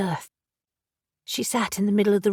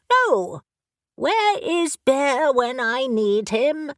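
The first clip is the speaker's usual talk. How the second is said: brisk, impatient talking raven; a raven who has searched everywhere and is exasperated; bossy raven; 5 semitones higher, slower than usual. Acted. Raven character voice.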